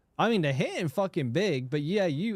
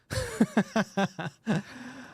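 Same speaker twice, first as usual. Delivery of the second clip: higher, staccato chuckle; real laugh; 6 semitones higher than usual. Real, not acted. Streamer laughing at where the message was sent, amused cackle. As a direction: burst out in a run of chuckles.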